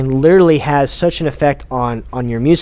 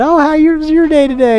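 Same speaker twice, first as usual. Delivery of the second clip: high, bright mock small talk, much higher and louder; chatty gym friend; 13 semitones higher, 4 dB louder than usual. Mocking gym-goers who chat with a friend instead of working out, high and sing-song. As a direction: mimic someone chatting idly on the treadmill. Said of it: "like, talking to your friend".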